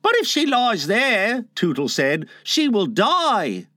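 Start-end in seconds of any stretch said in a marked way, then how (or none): 0.0-1.5 put-on high, worried boy; lightly voiced anxious boy; Tootles. A narrator's reading. 2.4-3.7 put-on high, drawn-out boy's warning; high, slow, fretful boy; Tootles. A narrator's reading. Tootles warns Wendy will die.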